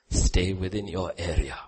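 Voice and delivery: ordinary talk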